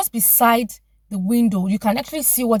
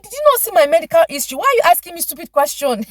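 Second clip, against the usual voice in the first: fast, loud, exasperated retort; irritated snap; 7 semitones higher, 4 dB louder, faster than usual. Retold or imitated. Patient snapping at the dentist, fast and irritated. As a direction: fire the questions back at her. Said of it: "I'm like".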